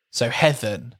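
"Heathen" with a short "e", "HETH-en"; heathen like Heather. Shown as a demonstration. Host demoing the swap, heathen like Heather. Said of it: "pronounced like the name Heather".

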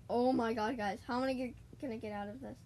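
normal talking voice

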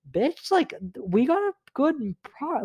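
high-pitched indignant snap at her; exasperated outburst. Retold or imitated. Telling off Michelle after the group project, high indignant outburst. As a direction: blurt it out, pitch shooting up in disbelief. Angry retort to Michelle in story.

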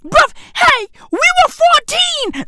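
shouting that they were only fourteen; emphatic shout. Real, not acted. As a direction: drive the point home, shouting. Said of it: "please note, okay?".

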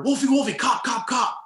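high, loud hushed-urgent call of the name, then cop, cop; urgent warning call. Retold or imitated. Teammate on the ground warning the painter, a high loud urgent call. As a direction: shout up to the guy on the statue that the cops are coming. Teammates warn of police arriving.

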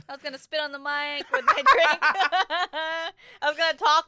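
laughing through the words; giggling, can barely get it out. Real, not acted. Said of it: "Why are you laughing?".

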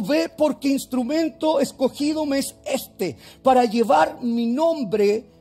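slow, loud preaching at full force; proclaiming shout. Acted. Pastor to congregation, quoting the Lord, slow loud proclamation. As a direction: thunder the Lord's words out, slowly.

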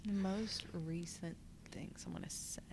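soft whisper, thinking aloud. Real, not acted. Guest whispering the question while searching her memory. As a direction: whisper the question over to yourself.

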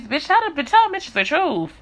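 real exasperated high call-out. Real, not acted. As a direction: call them out, voice jumping up. Calling out hypocrites.

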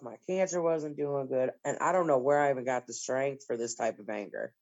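ordinary talk

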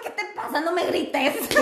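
high and laughing, telling him off; amused don't-yell-at-me; laughing protest. Retold or imitated. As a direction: laugh through a mock complaint. She scolds him, laughing.

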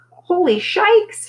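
high, drawn-out gasp of disbelief; slow, high astonished exclamation; breathed amazement. A narrator's reading. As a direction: stretch it out slowly, stunned. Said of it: "she breathed".

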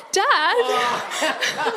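high, loud cry of embarrassment; imitating an embarrassed daughter's outcry; mortified protest. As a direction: call out dad, appalled but amused. Embarrassed daughter protests at dad's joke.

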